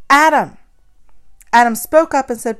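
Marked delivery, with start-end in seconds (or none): 0.1-0.6 loud, higher call; loud shout. Acted. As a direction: shout the name, louder. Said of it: "calling for Adam".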